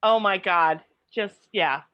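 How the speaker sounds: ordinary delivery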